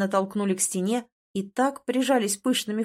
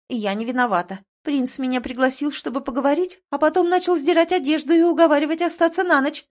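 high, louder, building babble; gabbling excuse; 6 semitones higher, 5 dB louder than usual. A narrator's reading. Girl blaming the prince before his brother, gabbling. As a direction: rattle off the excuse, climbing higher. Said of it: "вдруг затараторила девушка".

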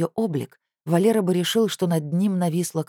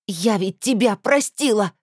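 louder, higher, furious cry; angry outcry; 6 semitones higher, 4 dB louder, slower than usual. Acted. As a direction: shout it in rage. Rita cries out in rage.

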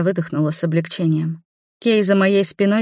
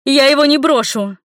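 high, loud refusal; desperate shout; 8 semitones higher, 5 dB louder than usual. Acted. Mother shouts she won't abandon her son, loud. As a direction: yell it while struggling free. Mother fights being carried away.

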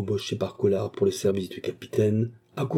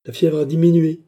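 loud, high, solemn pronouncement; 8 semitones higher, 8 dB louder than usual. A narrator's reading. Doctor pronouncing on his patient, solemn. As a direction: declare it like a grave doctor. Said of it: "continua le médecin d'un ton solennel".

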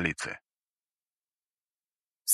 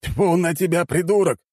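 higher, irritated rebuke; cursing; 11 semitones higher than usual. A narrator's reading. Commander rebukes overzealous soldier, irritated cursing. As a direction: snap at a foolish subordinate. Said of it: "выругался Роберто".

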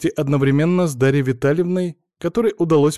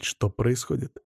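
ordinary delivery, nothing marked

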